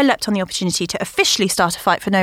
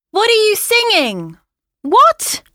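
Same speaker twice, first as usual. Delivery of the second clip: high, loud, calling question; shouted question; 4 dB louder, slower than usual. Acted. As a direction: shout the question across the house. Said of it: "shouted questions".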